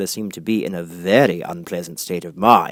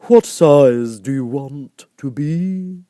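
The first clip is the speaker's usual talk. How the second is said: very slow, louder creature voice; caterpillar; 6 semitones higher, 8 dB louder, much slower than usual. A narrator's reading. Caterpillar asks Alice, slow drawl. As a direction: draw the question out lazily. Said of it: "it asked".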